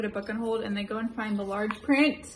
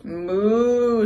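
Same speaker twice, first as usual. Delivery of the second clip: moo; 9 dB louder than usual. Acted. Animal sound in picture book.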